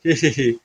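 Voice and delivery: acted hee-hee giggle; silly, chuckled hee-hee; giggling. Havaldar laughing at his bald boss, giggling. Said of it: "तुम ये हंस क्यों रहे हो".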